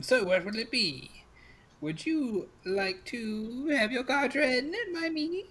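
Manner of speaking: slow crone voice climbing high at the end; coaxing old crone. Acted. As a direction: lure him into a reading with a rising witchy croon. GM plays the crone fortune teller.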